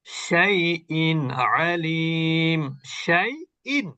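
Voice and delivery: slow, drawn-out recitation of শাইইন আলীম; clear nun. Shown as a demonstration. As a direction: separate শাইইন with a crisp, unhummed n. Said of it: "দন্তন্যের উচ্চারণ আছে স্পষ্ট". (শাইইন আলীম টেনে, নুন স্পষ্ট)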